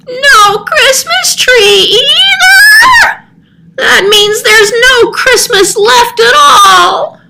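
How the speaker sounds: a cat lamenting that Christmas is lost; wailing cat. Acted. Cat devastated, crying out.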